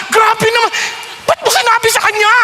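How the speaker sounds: high, fast startled whisper to God; nervous disbelief. Retold or imitated. Nervous young man in church, questioning God, startled high. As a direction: panic that he was called out. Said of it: "Sabi ko".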